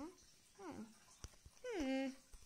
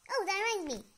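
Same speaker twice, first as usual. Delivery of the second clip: ordinary delivery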